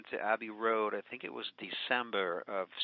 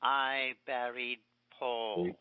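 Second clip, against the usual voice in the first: slow quoted John; much slower than usual. Retold or imitated. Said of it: "when John says".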